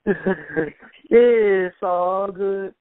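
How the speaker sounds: high, very slow It's all good; teasing prank-call voice. Retold or imitated.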